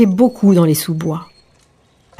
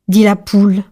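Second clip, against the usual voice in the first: ordinary delivery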